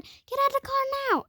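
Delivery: very high, rushed mock scream; retold scream. Screaming mom orders child out, high mock scream. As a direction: squeal the order fast, imitating a screaming mom. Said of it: "she started screaming".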